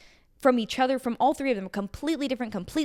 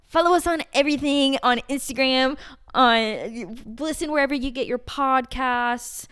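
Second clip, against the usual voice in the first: announcer voice; 3 semitones higher, 3 dB louder than usual. Pretend. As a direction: call it out like a fight announcer. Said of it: "Should I get my announcer voice on?".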